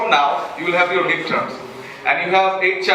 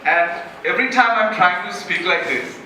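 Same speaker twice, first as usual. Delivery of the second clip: normal talking voice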